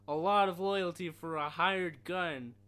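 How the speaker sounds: slow, loud agent taunt; annoying CIA agent voice. Retold or imitated. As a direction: sneer it like the agent. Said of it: "the CIA guy has the most annoying fucking voice".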